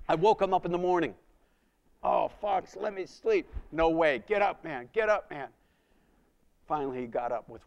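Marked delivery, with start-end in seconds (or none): 2.0-3.5 mock groggy plea, pitched up; sleepy protest. Brother woken early begs to keep sleeping, groggy whine. 3.7-5.5 loud, higher wake-up call; rousing call. Retold or imitated. Waking his sleeping brother in the morning, loud rousing call.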